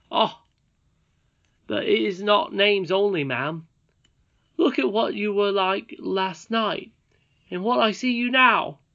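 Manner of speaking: put-on a young miner boy asking boldly; eager boy Curdie.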